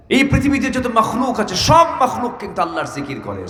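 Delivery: high opening swooping down at the end; impassioned preaching. Real, not acted. Preacher tells crowd all creation remembers Allah, impassioned preaching. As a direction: proclaim it to the crowd from a high start.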